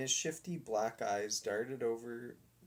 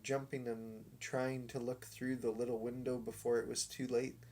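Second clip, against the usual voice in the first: ordinary delivery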